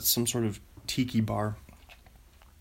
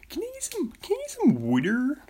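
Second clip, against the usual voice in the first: put-on Philadelphia accent; faster than usual. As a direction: ask for a drink like a brash Philly local. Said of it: "how you ask for water in Philadelphia".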